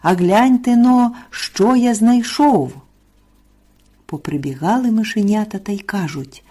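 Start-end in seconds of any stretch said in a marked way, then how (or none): 0.0-2.7 high, loud call to come and look. A narrator's reading. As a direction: call out a find, drawing out the end. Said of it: "став гукати півник". (гукає високо й голосно)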